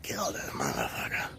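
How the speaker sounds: whispered "kill this motherfucker", low and hushed; retold whisper. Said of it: "he's going".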